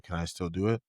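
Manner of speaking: ordinary talk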